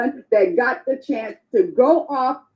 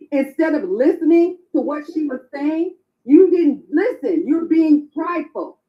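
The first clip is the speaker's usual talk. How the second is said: normal talking voice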